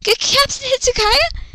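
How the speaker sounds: put-on high cutesy girl voice; high girly voice. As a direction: chirp it like a cute anime girl. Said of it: "Luna speaks".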